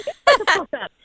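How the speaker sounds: high-pitched giddy outburst; elated disbelief. Retold or imitated. Agent tells how she reacted at the open house, high and giddy. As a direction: exclaim it high, reenacting happy shock.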